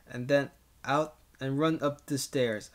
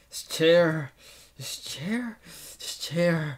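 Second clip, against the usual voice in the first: angry; 3 semitones higher, 5 dB louder, slower than usual. Acted. Smashing chair continues.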